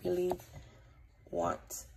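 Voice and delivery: really really fading into whisper. Real, not acted. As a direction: trail off to a breathy whisper. Pleading for subscribers, fading out.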